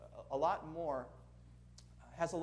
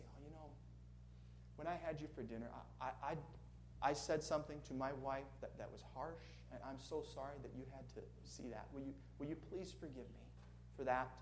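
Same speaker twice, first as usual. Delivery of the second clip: soft, halting, sincere apology; humble apology; 4 semitones lower, 12 dB quieter than usual. Retold or imitated. Older man asking the young man's forgiveness, softly. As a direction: speak gently, like a contrite mentor. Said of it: "you ask him to forgive you".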